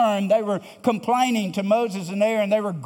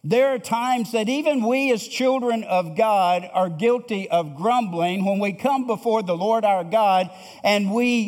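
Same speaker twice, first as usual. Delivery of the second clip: ordinary talk, nothing marked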